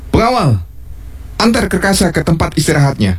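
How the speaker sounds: high royal order to a guard; commanding. Acted. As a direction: give the order like a king.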